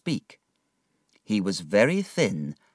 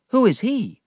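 put-on higher boyish question; curious boy; 5 dB louder than usual. David asking about Mr Wickfield, curious. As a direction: ask eagerly.

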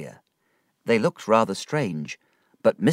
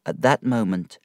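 ordinary delivery

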